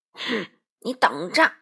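loud, slow growling threat; angry roar. A narrator's reading. Wolf charging out at the crow, roaring. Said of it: "帅狼吼叫着".

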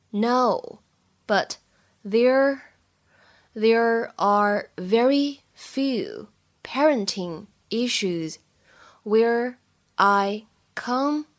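very slow, one word at a time; 逐词慢读. Shown as a demonstration. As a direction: read it word by word with clear gaps. Teacher models sentence word by word. (一词一顿地慢速示范)

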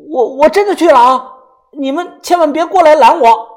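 high, loud, hammed-up threat; stammered, theatrical yell; shouting. A narrator's reading. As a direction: call back in hoping to be stopped. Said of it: "探头进来喊道".

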